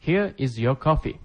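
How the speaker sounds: slow, careful model reading; slow repetition. Shown as a demonstration. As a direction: spell it out slowly for learners. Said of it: "teacher repeats phrase for learners".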